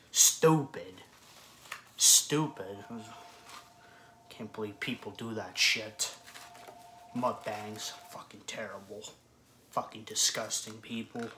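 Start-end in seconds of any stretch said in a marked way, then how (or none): 0.1-1.0 angry shout. Real, not acted. Ranting about mukbangs. 2.0-2.8 angry repeat. Real, not acted. Ranting about mukbangs. 8.1-9.0 hushed disgusted aside; whispering. Real, not acted.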